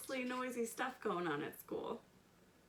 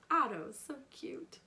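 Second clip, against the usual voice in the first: ordinary delivery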